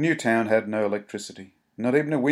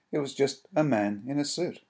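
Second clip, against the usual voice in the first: usual speaking voice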